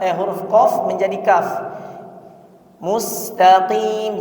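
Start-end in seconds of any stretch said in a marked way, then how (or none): 2.8-4.2 "Mustaqim" with a deep qaf at the back of the throat. Shown as a demonstration. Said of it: "jangan diganti huruf kaf". (qaf tebal pada "Mustaqim")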